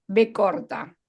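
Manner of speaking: usual speaking voice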